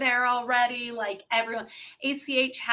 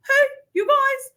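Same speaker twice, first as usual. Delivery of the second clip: much higher, mimicking George's voice; 4 dB louder than usual. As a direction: do George's high, odd greeting. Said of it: "He talks like that".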